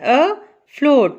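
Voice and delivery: "afloat" in two parts, a - float, pause between; split into syllables. Shown as a demonstration. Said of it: "afloat है इसके to parts बनते हैं". ("afloat" को दो हिस्सों में, a - float)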